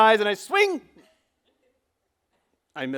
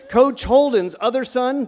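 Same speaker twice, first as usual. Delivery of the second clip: ordinary talk, nothing marked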